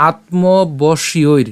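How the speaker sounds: আত্মবশ্যৈর drawn out very slowly; slow syllable demo. Shown as a demonstration. Teacher demonstrating আত্মবশ্যৈর slowly. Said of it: "এরপর".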